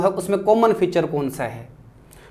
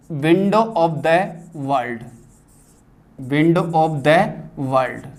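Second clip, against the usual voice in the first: slow dictation; 3 semitones lower, much slower than usual. Shown as a demonstration. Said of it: "teacher dictates phrase slowly, twice".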